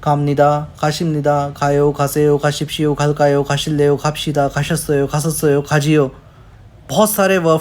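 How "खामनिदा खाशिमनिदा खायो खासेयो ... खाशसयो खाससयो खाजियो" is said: each Korean form of खादा read out one by one, unhurried; Korean verb forms. Shown as a demonstration. Teacher reading Korean verb forms to Hindi learners, careful drill. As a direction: list the Korean conjugations like a teacher drilling them. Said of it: "जाना का Korean verb देखें".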